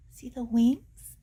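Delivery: plain reading voice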